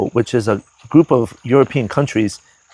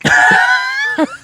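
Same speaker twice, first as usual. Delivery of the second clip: high, bursting shout of a laugh. Real, not acted. Reacting to monks speaking.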